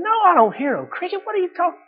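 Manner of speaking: high-pitched mock disbelief; squeaky-high, bewildered denial; baffled businessman. Businessman answers his companion about a cricket, baffled and high-pitched.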